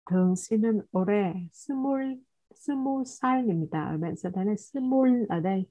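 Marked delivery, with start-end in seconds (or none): none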